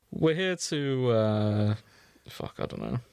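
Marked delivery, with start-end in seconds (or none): none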